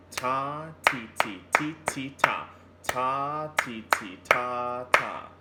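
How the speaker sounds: chanted ta and ti rhythm; clapped-out ta ti rhythm chant; rhythm syllables. Shown as a demonstration. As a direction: chant "Ta, ti, ti" beat by beat. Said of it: "Your turn".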